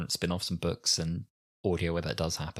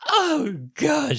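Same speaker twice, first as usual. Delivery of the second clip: hammed-up cry of dismay, far higher and louder; mock dismay; 6 dB louder than usual. [name] after falling down the gentle slope, mock wail. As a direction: wail it like a fainting heroine.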